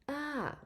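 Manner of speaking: "ah" starts high then falls down; falling tone ah. Shown as a demonstration. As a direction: lift "ah" high and let it drop. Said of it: "The voice goes up and then back down".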